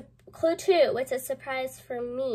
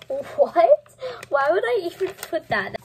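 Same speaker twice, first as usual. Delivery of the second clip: surprised; 4 semitones higher, 8 dB louder than usual. Real, not acted. Reacting to own old diary.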